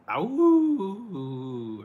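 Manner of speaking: a sudden high loud yelp; shrill mock yelp. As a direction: squeal it out like a toy. Toy sound imitation.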